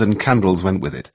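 ordinary delivery, nothing marked

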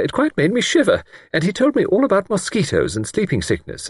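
high, chattering lady's voice; lightly voiced eager village spinster; fluttery spinster. A narrator's reading. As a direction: prattle on eagerly. Miss Wainfleet voiced high.

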